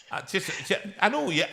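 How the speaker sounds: ordinary talk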